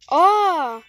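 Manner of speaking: sudden loud gasp of surprise; a startled "Aa!" as the tide turns; surprised gasp. Real, not acted. Surprise in game.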